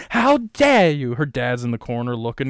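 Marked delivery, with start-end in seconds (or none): 0.1-1.1 higher, louder indignant Greta impression; mock Greta. Mocking Greta's outrage, higher and louder. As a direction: play Greta's outrage for laughs.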